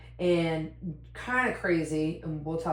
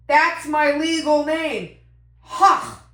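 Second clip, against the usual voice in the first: imitating Mac; 10 semitones higher, 11 dB louder, much slower than usual. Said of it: "He goes".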